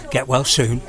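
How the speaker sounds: ordinary talk, nothing marked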